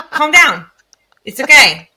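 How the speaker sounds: high, loud imitation of a small child; little child telling off mom. Small daughter telling mama to calm down, emphatic. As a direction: pipe up like a tiny kid taking charge. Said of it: "little, like".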